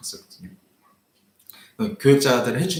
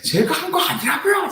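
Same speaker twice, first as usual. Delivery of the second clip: much higher retold yell; shouting. Pastor shouting at the old man that he didn't park there. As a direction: burst out in frustration. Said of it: "제가 소리를 ... 팍 터진 거예요".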